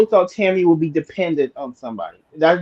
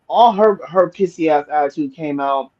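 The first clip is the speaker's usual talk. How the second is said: usual speaking voice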